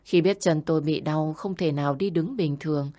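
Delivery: plain reading voice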